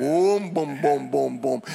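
imitated truck rumble. Imitating dad's diesel truck.

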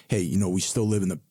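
usual speaking voice

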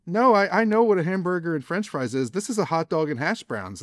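high, exasperated insistence; mock indignant diner who knows his order; exasperated customer. The diner insists to the waiter, high and exasperated. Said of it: "And you say".

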